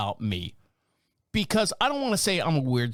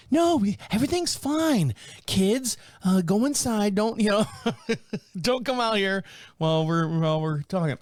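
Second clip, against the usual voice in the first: high fake-cheerful mom brushing off trouble; judgy mom; 4 semitones higher than usual. Judgy mom covering for the kids, high fake cheer. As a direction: shoo the kids inside, sweetly. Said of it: "the judgy mom".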